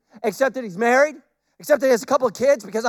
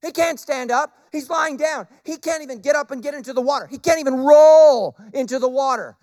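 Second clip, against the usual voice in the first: ordinary delivery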